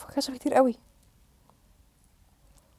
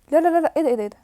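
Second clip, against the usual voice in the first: gasping, loud no no no; startled cry of what is this; shocked gasp; 4 semitones higher, 4 dB louder, much faster than usual. Real, not acted. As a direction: caught off guard, alarmed. Sudden attack in the game.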